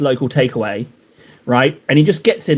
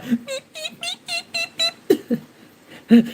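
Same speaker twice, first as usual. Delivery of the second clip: very high, squeaky chirping beeps; mock baby bird beeps; 5 dB quieter, slower than usual. Streamer mimics a baby bird begging for fish heads, squeaky beeps. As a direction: peep like a hungry chick in the nest. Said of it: "he's like".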